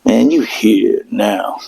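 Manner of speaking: low, slow demonic growl. Retold or imitated. GPS greeting him as a demon, slow and low. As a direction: drag it out like a demon. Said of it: "it goes".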